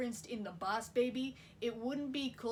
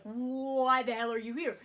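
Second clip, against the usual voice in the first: louder, slow, stunned outburst; flabbergasted; 7 dB louder, slower than usual. Retold or imitated. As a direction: blurt it out in disbelief.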